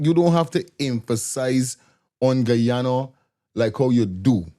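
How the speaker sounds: usual speaking voice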